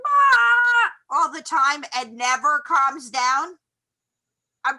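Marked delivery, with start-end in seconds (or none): 0.1-0.9 high, loud panicky "ah"; mock frantic yell. Imitating a person who never calms down, frantic yell. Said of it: "that is just like".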